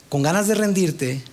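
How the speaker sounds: ordinary talk, nothing marked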